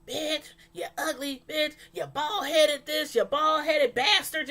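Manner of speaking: imitating the girlfriend yelling insults from the truck; mock Donna insult. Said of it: "Donna was up in the truck".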